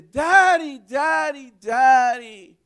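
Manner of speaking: put-on high, loud cry of a hurt little girl; little daughter running to her father, crying out in pain; hurt child calling. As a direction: call for daddy like a child who cut herself. Y viene y dice.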